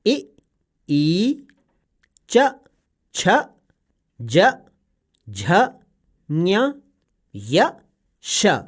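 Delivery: इ, ई, च, छ, ज, झ, ञ, य, श each said separately with clear pauses; palatal letter drill. Shown as a demonstration. Parrot showing the child the palatal sounds, letter by letter. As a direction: model each palatal letter slowly and cleanly. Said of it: "इसका उच्चारण है".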